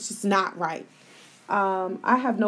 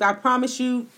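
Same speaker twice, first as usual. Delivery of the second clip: ordinary delivery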